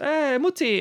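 put-on high child calling to mum; excited kid shouting for mother. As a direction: pitch way up like a little kid. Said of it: "one kid runs up to her and says".

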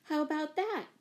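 high, eager question; enthusiastic. A narrator's reading. Jane putting her adoption idea to mother, enthusiastically. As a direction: pitch it up, keen for a yes. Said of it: "cried Jane enthusiastically".

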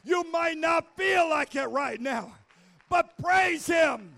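loud, rising shouted preaching; preaching at full force. Acted. Pastor rallying the church to stand and praise, full-force preaching. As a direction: whip the congregation up to praise. Urges congregation to praise God.

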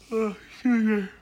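yawning mid-sentence; words stretched by a yawn. Real, not acted. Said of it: "you got me fucking yawning".

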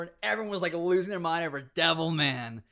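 ordinary talk

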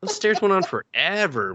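high, exasperated complaint; incredulous rant. Real, not acted. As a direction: question them like it's absurd. Ranting at the characters.

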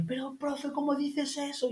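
high, outraged child's voice, imitated; indignant kids. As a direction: mimic shocked pupils scolding their teacher. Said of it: "Indignados ahí".

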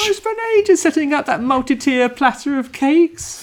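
high, indignant host voice; fussy high voice. Pretend. Voicing the picnic owner.

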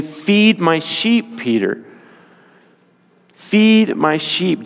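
slow, deliberate, driving the point home; slow emphatic command. Retold or imitated. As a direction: lean on feed, word by word. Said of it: "Jesus was driving it home to him".

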